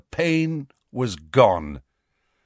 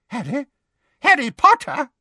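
astonished; 14 semitones higher, 4 dB louder than usual. Acted. Fudge astonished.

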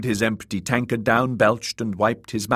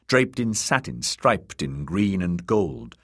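ordinary delivery, nothing marked

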